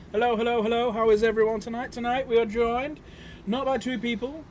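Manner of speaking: loud. Real, not acted. Streamer greeting chat loudly.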